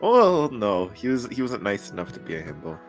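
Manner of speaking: usual speaking voice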